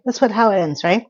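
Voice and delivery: usual speaking voice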